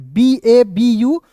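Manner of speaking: very slow, each letter set apart; teacher spelling a word slowly for beginners. Shown as a demonstration. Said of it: "Babu.".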